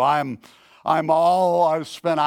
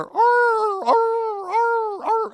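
high yelping dog cries, much higher; the preacher mimics a neighbor's dog howling over the phone, high yelping cries; imitated dog howl; 4 dB louder, much slower than usual. Preacher imitating a distressed dog to his congregation, high howling yelps. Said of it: "You know, I hear".

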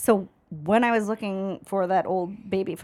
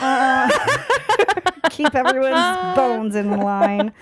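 laughing; 4 semitones higher, 8 dB louder, much slower than usual. Retold or imitated. Mocking coworkers' wholesome talk.

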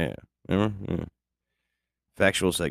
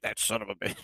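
imitating Rogan; 3 semitones higher, 10 dB quieter, faster than usual. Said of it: "Old Rogan would be like".

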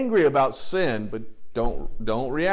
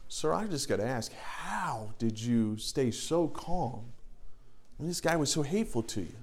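soft, curious little lady voice; little lady asking; 11 dB quieter than usual. Retold or imitated. Little lady asks the baggage man, softly curious. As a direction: gently ask how he stayed calm. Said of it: "She goes".

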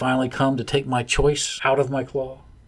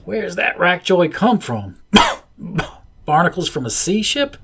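put-on young pup sputtering; 6 semitones higher, 4 dB louder than usual. A narrator's reading. Pup gags on drug.

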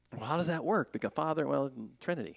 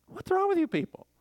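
much higher, strained voice of disbelief; exasperated outburst; 4 dB louder than usual. Retold or imitated. Preacher retelling his reply to complacent churchgoers, exasperated. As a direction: throw up your hands at the crowd. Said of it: "And I'm like".

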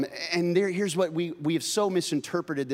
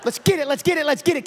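loud, high hype shouting; kid coaching mom; 9 semitones higher, 6 dB louder than usual. Retold or imitated. Child yelling at mom about SpaghettiOs, loud hype. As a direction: cheer her on like a sideline coach. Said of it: "kids started talking to us like that".

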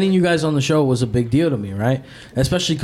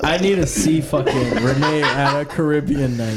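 higher, laughing while talking; real laughter at a funny picture; 6 semitones higher, slower than usual. Real, not acted. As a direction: crack up at the image. Laughing at Renee image.

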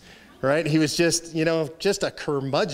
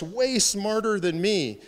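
ordinary talk

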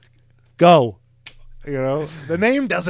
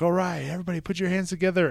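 host intro; 7 semitones higher, faster than usual. Retold or imitated.

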